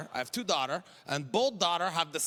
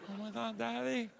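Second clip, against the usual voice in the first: higher, gushing daughter voice; excited daughter; 5 semitones higher, 4 dB quieter than usual. Retold or imitated. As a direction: squeal over the shirt like a thrilled teen. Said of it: "She's like".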